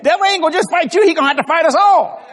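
high, loud preacher's rally; fired-up preaching shout. Real, not acted. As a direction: whip the church up, voice climbing. Preacher rallying congregation against devil.

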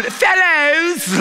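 mock British accent, laughing at the line. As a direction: hail them in a posh British accent. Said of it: "like he got a British accent".

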